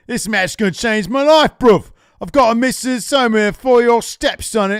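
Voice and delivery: put-on loud, high London accent; Will Ospreay impression. Host doing Will Ospreay's promo, loud cockney. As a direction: shout it like a cocky English wrestler, bruv. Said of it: "your Will Ospreay impression".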